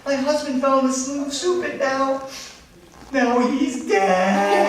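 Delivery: high lament slowing into a drawn-out wail; hammed-up tearful wife; mock crying. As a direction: sob out the news theatrically, stretching the end. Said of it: "why are you crying?".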